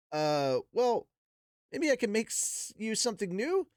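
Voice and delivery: a lightly voiced nervous boy; hesitant Rudeus. Rudeus offering Zenoba a new sculpture, hesitant.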